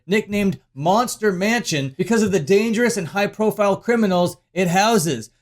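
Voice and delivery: ordinary talk, nothing marked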